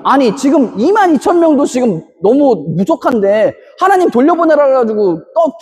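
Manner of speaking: normal talking voice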